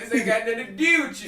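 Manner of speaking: thick mock Baltimore accent through laughter; exaggerated Baltimore accent. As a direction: overdo the Baltimore "do" and "you". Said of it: "Sounds like Canada".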